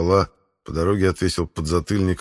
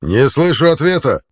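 loud, high barked demand; 7 semitones higher, 7 dB louder than usual. A narrator's reading. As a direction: bark it in his ear. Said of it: "рявкнул Центурион".

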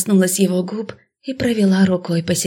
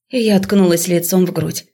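ordinary delivery, nothing marked

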